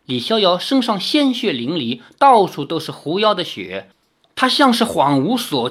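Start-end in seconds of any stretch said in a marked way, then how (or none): none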